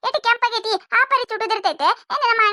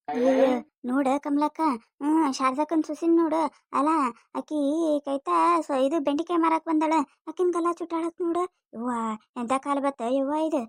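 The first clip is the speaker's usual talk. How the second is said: a lower-voiced village neighbour clucking over the scandal; gossiping old neighbour; 6 semitones lower, 5 dB quieter than usual. Neighbour woman gossips about daughter-in-law. (ತಗ್ಗಿದ ದನಿಯ ಮುದುಕಿ ನೆರೆಹೊರೆಯವಳು)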